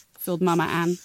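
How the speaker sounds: usual speaking voice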